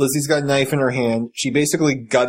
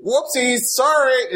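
much higher, breezy fake apology; Carol's offhand sorry after nearly shooting Rick; mock casual oops; 12 semitones higher, slower than usual. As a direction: toss it off like a careless shrug.

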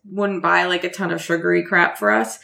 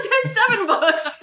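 laughing correction; 11 semitones higher, slower than usual. Retold or imitated.

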